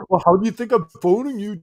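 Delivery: high, fast, mocking mother voice; sassy ghost mother. Host voicing the dead mother scolding her daughter, fast and sassy. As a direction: tease as the dead mum, quick and snarky.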